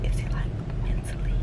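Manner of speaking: voiceless whispered aside to camera; real hushed whisper. Real, not acted. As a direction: whisper it so the other person can't hear. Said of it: "You don't have to whisper".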